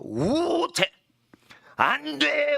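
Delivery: high, drawn-out proclamation; emphatic prohibition. Acted. Preacher acting out the original text's list of bans, emphatic prohibition. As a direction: intone each ban high and forcefully.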